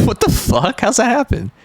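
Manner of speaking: a stunned, giggly what the; laughing shock. Real, not acted. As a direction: burst out in baffled laughter. Shocked by something on screen.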